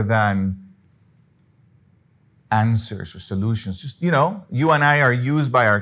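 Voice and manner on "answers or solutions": ordinary talk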